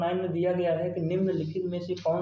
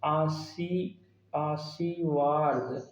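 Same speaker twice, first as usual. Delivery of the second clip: "आशीर्वाद" very slowly, part by part; drawn-out reading; much slower than usual. Shown as a demonstration. Teacher reading out a spelling option to check, "आशीर्वाद" slowly syllable by syllable. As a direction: stretch each syllable of "आशीर्वाद". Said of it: "वर्तनी की दृष्टि से देखिए क्या".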